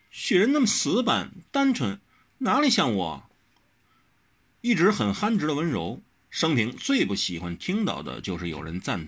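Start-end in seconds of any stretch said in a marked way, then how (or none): 0.1-3.3 much higher, insistent; pressing demand. A narrator's reading. Wen Rou grilling Wang Xiaoshi, higher and insistent. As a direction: fire the questions at him. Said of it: "温柔灼灼地问".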